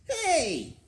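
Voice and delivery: sudden loud high yelp; startled shout. Acted. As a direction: cry out as if grabbed. Beast ambushed in the story.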